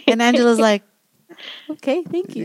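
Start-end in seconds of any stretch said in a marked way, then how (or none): 1.7-2.4 soft, dainty thank-you; small sweet voice; cute little voice. Retold or imitated. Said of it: "And just cute little voice".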